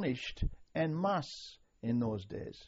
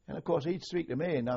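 usual speaking voice

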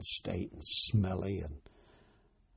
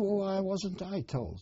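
high, slow, aggrieved asking; retold indignant question; 11 semitones higher than usual. To his pals who left him sleeping, high slow indignation. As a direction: pitch it up and drag it out, put out. Soldier protests missing the weighing.

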